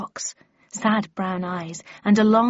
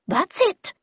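much higher character voice in reply; high-pitched, assured reply; Miss Havisham; 11 semitones higher than usual. Acted. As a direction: answer in a high, lightly put-on lady's voice. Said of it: "replied Miss Havisham".

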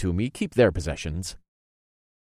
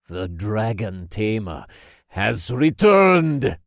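ominous; 3 dB louder, slower than usual. A narrator's reading. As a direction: intone it ominously, swelling on the last words. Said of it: "said in an ominous tone".

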